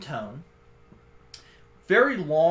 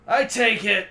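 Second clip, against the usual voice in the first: raised, higher voice; orc confronting intruders; 7 semitones higher, 8 dB louder than usual. Acted. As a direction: open loudly as the orc. Orc addresses intruders in acted voice.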